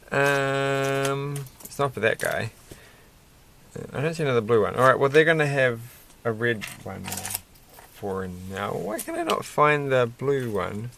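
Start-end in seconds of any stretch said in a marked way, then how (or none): none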